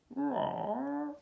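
high, drawn-out dinosaur roar; rising mock roar of agreement; agreeing roar. A narrator's reading. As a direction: roar a yes like a T-Rex. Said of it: "agreed Trevor".

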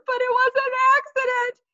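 high, loud wailing excuse. A narrator's reading. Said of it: "Sheila cried louder".

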